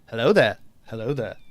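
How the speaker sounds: low British film-hero greeting; Obi-Wan Kenobi. Retold or imitated. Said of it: "after watching Star Wars going".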